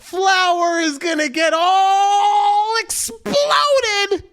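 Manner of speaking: high, loud, drawn-out crowing; exultant mock wail. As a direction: stretch the key words into long high cries. Crowing about tonight's payoff.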